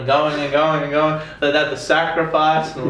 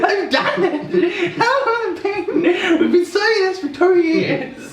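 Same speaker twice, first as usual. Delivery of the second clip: high, slow, laughing through the words; cracking up in disbelief; high laughing exclaim; slower than usual. Real, not acted.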